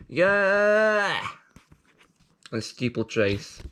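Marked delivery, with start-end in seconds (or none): 0.1-1.2 excited cheer. Real, not acted. Cheering after sting.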